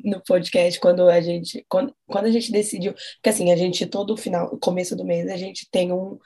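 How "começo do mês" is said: ordinary talk, nothing marked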